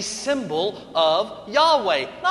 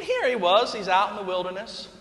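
ordinary delivery

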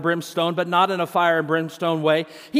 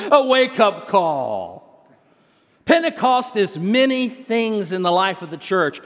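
ordinary delivery

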